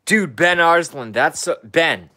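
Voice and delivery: loud, high burst of delight; excited shout. Real, not acted. Hyped shout-out to fellow musician [name], loud and excited. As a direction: yell his name out thrilled.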